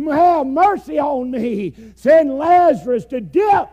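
high pleading shout from the fire; desperate plea. Acted. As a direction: beg for a drop of water, crying out. Rich man begging from hell.

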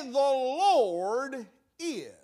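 "the Lord is" dragged out slowly, stress on Lord; slow, weighty phrase. Shown as a demonstration. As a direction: linger on every word of "the Lord is". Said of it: "May I say".

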